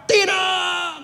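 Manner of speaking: high shouted no. Acted. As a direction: refuse it flat out. Pious man refuses rescue. (orang saleh menolak dengan berteriak)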